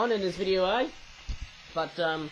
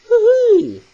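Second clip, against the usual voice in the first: excited whoop; 10 dB louder than usual. Real, not acted. Bat toy folded into accordion.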